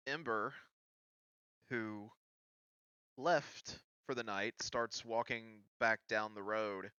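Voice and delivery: ordinary talk, nothing marked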